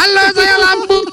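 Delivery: high squeaky belly voice; stomach voice. Pretend. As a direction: speak as a talking stomach. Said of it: "Itu suara perut".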